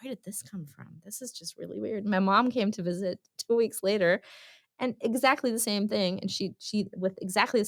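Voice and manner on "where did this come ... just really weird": hushed puzzling. Retold or imitated. Mom puzzling over her own phrase.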